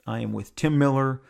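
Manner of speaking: ordinary talk, nothing marked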